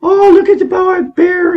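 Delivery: squealed mock delight of zoo visitors; mocking gawker. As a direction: coo it like a gawking zoo visitor pointing at the bear. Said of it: "And then the people are".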